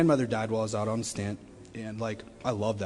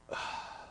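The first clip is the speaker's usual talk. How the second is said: heavy sigh; 12 dB quieter than usual. Real, not acted. Doors close, left alone.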